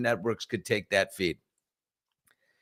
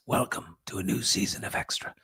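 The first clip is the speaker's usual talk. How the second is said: soft unvoiced whisper; whispered show opener; 2 semitones lower, 7 dB quieter than usual. Shown as a demonstration. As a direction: breathe the words like a hushed broadcast opening. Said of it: "Whispering as the Queen comes up".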